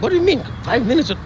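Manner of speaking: high, fast, disbelieving question; the shocked young student pushing back; incredulous. Retold or imitated. Young rabbi shocked by teacher.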